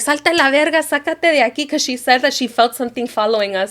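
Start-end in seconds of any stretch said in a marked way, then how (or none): 0.0-1.7 mildly raised pitch, imitating an urgent mom; retold order to get out, lightly heated; mom going off. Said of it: "my mom starts going off".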